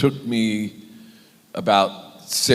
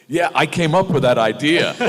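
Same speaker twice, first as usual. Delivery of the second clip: higher-pitched, eager boast; proud actor; 8 semitones higher, 4 dB louder than usual. Retold or imitated. Actor retelling his answer to his horrified mother, proud and eager. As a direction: own up to it with an actor's pride. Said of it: "the actor in me and I went".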